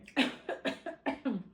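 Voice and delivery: harsh coughing fit from choking. Real, not acted. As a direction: cough hard, caught in the throat. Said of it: "It's so good, I'm choking".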